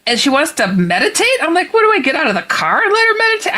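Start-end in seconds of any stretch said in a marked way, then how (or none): 1.7-3.5 high, rushed incredulous question; retold bafflement. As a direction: race through it high-pitched, utterly baffled. Baffled by the odd request.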